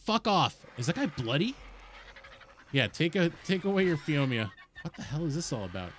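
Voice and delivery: usual speaking voice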